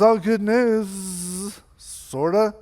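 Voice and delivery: slow, trailing acted hesitation; hedging, drawn-out delivery; reluctant news-breaking. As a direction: stretch it out, dreading the reaction.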